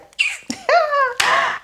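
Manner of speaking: long, high-pitched mock sigh; imitated heavy sigh. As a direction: heave an exaggerated sigh, high and drawn out. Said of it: "He heaved a sigh".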